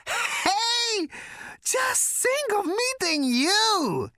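very high, slow, breathless squeal; gasped delight. Acted. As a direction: gasp it out in giddy awe. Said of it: "he gasped".

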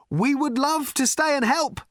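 high-pitched shout over the chaos. A narrator's reading. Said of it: "shouted Ford".

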